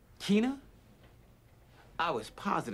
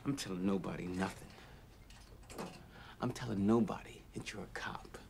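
whispered taunt; 2 semitones lower, 6 dB quieter than usual. Acted. Mocking after lights out.

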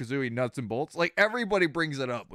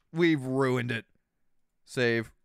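normal talking voice

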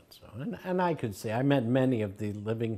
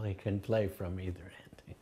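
soft whisper; 4 semitones lower, 7 dB quieter than usual. Retold or imitated. Quoting guru's gentle joke.

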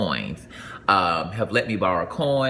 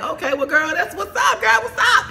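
very high, laughing and shouting; high, laughing celebration for the bride; thrilled cheering; 4 dB louder than usual. Retold or imitated. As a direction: hype her up, squealing. Hearing she got engaged.

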